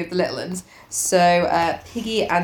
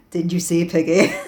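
mock sexy; 3 semitones lower than usual. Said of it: "Oh, sexy".

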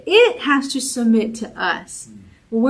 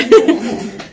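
burst of laughter; 4 semitones higher, 6 dB louder than usual. Real, not acted. Laughing about dancing.

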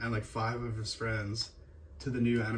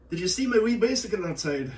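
high, loud Scottish teacher impression; Scottish accent; 8 semitones higher, 5 dB louder than usual. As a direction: ask it in a put-on Scottish lilt. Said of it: "off-the-cuff Scottish".

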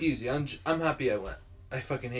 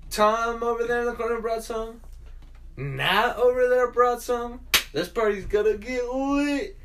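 hyped party boast; 10 semitones higher, 8 dB louder than usual. Retold or imitated. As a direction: sell the party like a hype man. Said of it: "I was like".

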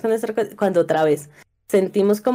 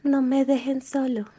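put-on child voice; 4 semitones higher, 6 dB quieter than usual. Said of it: "en voz de niño".

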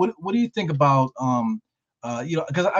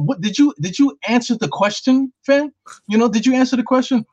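climbing higher and louder, fed up; exasperated demand; 9 semitones higher, 4 dB louder than usual. Real, not acted. Podcast host fed up with the evasive coach, exasperated high demand. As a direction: demand a straight answer, rising in pitch.

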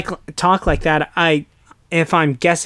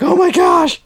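very high, loud panicked shriek; mock scream; 6 dB louder than usual. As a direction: scream your head off like a terrified bank teller. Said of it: "just screaming their head off".

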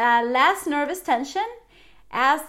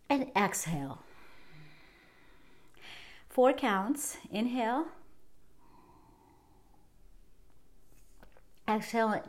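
guided breathing; 8 dB quieter than usual. Shown as a demonstration. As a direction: calmly guide the viewer through each breath. Leads a breathing exercise.

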